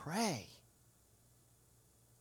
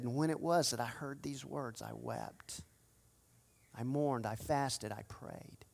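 much softer, fading to a whispered last word; hushed mournful confession; 7 semitones lower, 9 dB quieter than usual. Acted. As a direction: let Nehemiah's grief sink to a breath. Nehemiah quoted over music.

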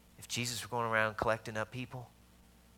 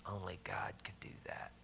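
soft whisper, almost no voice; whispered remark; 8 dB quieter than usual. Retold or imitated. Older gentleman quietly praising the church, whispered. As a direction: breathe it out like a secret.

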